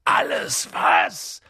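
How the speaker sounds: slow, low rasping giant roar; thundering Hagrid. A narrator's reading. Giant incredulous at the boy's question, thundering. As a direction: thunder it back, drawn out and deep. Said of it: "donnerte Hagrid".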